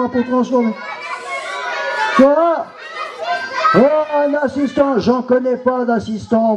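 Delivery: a nasty puppet villain crowing; gloating villain. Acted. Villain returns.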